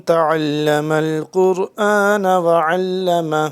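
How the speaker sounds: slow, loud chanted Arabic recitation; Quran recitation. Acted. Teacher opening a Quran class, chanted slow recitation. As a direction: intone it like a reciter opening a lesson.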